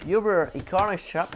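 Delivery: lightly put-on English accent; accent anglais. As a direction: say the brand names with an English accent. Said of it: "Quel accent anglais". (petit accent anglais appuyé)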